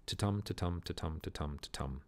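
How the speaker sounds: soft da-DUM beats, weak then strong, fading away; iambic rhythm. Shown as a demonstration. Teacher demonstrating the iamb rhythm to the class, softly chanted. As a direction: tap out ta-tum with the stress on tum. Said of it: "an iamb is a rhythm like this".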